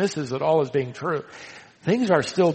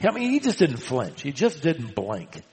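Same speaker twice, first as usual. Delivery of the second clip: normal talking voice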